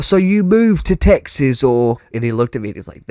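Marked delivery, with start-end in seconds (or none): 0.0-2.0 high, slow puzzled Brit; imitating a British man working it out; puzzled, drawn-out British accent. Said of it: "somewhere in the U K".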